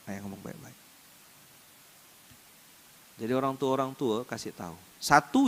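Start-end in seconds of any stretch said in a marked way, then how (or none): 0.1-0.8 very soft, low little reply; hushed imitation of a cowed child; meek child's answer. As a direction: answer dad submissively, almost inaudibly. Child answers father's warning meekly.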